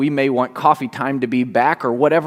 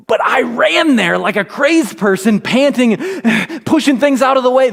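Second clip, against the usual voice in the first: higher, louder, breathless retelling; frantic; 10 semitones higher, 4 dB louder than usual. Retold or imitated. Father racing to his daughter, frantic and out of breath. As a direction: relive the panic, panting. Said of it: "got a bit frantic and worked up".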